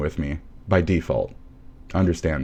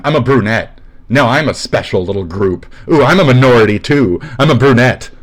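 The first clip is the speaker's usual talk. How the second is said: louder, higher sarcastic mimicry; mocking voice; 4 semitones higher, 5 dB louder than usual. Mocking a commenter who wants to be special. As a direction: ham up a whiny brunette.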